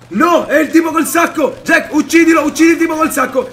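high, loud panicked commands; real frightened shouting; alarmed shout. Real, not acted. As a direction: yell at Jack to kill him. Alarmed, orders Jack to kill.

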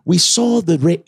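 lightly done British narrator voice; a hint of British documentary voice; British accent. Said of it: "British people narrate".

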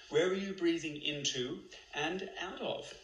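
ordinary delivery

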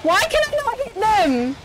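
high, loud exasperated yell; raging gamer. Real, not acted. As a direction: shout the question in frustration. Can't hit enemy.

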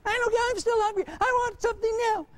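put-on high, fast whining kid voice; high-pitched child demanding food now; whiny child. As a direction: pester like a hungry little kid. Child insists on a snack.

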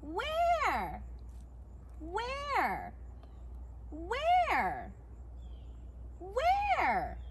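drawn-out where, rising high then dropping; kids' show questioning. Acted. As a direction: stretch the word out for the viewers. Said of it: "you can just go, "Where?".

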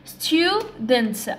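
"Students" as two separate beats, stu and dents; split into syllables. Shown as a demonstration. Teacher showing two syllables, "Students" split in two. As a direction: break "Students" after stu. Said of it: "عندها two syllables كذلك".

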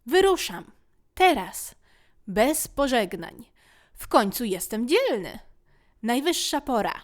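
put-on rally yourself like a brave little boy; excited boyish proclamation; bold declaration. Boy boldly declaring departure.

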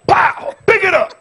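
high, very loud screamed order; screamed command. Retold or imitated. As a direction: scream it over a screaming child. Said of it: "I'm screaming right along with you".

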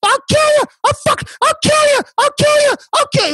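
very high, rapid crazed squeal; losing his mind. Retold or imitated. Man on top of his attacker, losing his mind. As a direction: imitate yourself freaking out in a frantic falsetto. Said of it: "I'm losing my mind".

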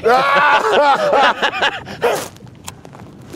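loud, high cackling burst; laughing. Real, not acted.

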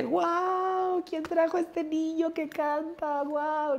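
high, slow, awed exclamation; high-pitched wondering guau; amazed mothers. Retold or imitated. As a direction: gush like astonished mothers. Mothers amazed by child singer.

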